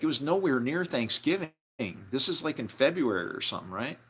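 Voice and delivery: ordinary talk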